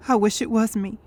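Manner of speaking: low, heavy grief; despairing wish. Acted. Survivor wishing to have died instead, low despair. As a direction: say it low and drained.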